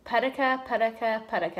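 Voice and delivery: "Pudika" said as one quick three-syllable word; pudika demo. Shown as a demonstration. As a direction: roll out "pudika" rhythmically. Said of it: "the last thing I want you to say is".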